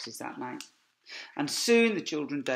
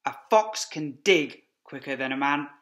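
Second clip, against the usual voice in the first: shouting; 8 dB louder than usual. A narrator's reading. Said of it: "shouted Mister Fox".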